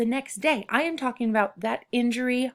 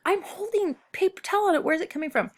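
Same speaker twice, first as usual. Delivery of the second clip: high, startled exclamation; panicked, can't believe the bleeding; alarmed disbelief; 10 semitones higher, faster than usual. Retold or imitated. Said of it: "And I'm like".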